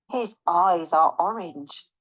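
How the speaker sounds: ordinary delivery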